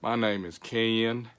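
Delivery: slow Georgia drawl, Ken in extra syllables; Southern Georgia drawl with a long two-part Ken; Georgia accent. Acted. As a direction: introduce yourself Georgia style, stretching Ken. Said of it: "It's got a lot of syllables".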